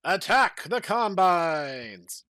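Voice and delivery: loud, slow drawn-out battle cry; rally the troops into attack. Acted. Henry Freeman orders the charge, slow loud battle cry. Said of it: "Henry Freeman yelled again".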